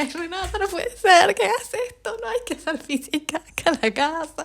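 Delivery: very high, mock-tearful scolding; imitated crying. Owner tells Renata off about the mess, mock crying. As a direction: plead in a hammed-up squeaky wail. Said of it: "porque yo lloré esa vez".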